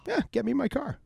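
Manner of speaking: very high reenacted demand for his car; mock bossy order. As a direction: order it like an entitled drunk. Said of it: "I'm like".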